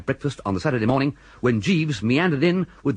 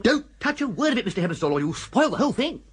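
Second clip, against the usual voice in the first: eager insistence; 9 semitones higher than usual. Acted. Bertie urging the vicar eagerly.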